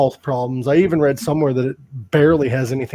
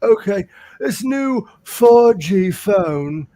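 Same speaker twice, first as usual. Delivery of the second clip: slow, higher, louder fumbling of the line; 5 semitones higher, 4 dB louder, much slower than usual. Retold or imitated. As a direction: get the line wrong in a slow daze. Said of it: "He's like".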